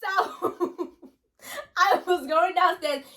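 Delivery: high, helpless laugh through the words; laughing exasperation. Real, not acted. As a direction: struggle to speak through laughter. Daughter interrupted, breaks into laughing.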